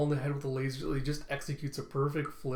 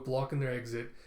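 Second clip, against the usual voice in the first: ordinary delivery, nothing marked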